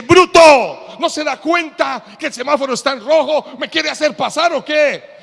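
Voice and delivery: furious yelling. Retold or imitated. Driver gets out and yells furiously at the honker. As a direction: scream at him with real fury. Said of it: "Se baja el hombre furioso".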